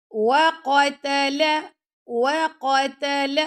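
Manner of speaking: وَقَتَلَ chanted with thick ق, thin ت and ل; heavy qaf. Shown as a demonstration. Teacher showing students letter weights, وَقَتَلَ with heavy qaf. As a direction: make only the قَ of وَقَتَلَ heavy. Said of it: "اب قاف موٹا ہے".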